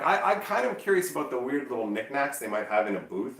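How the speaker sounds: normal talking voice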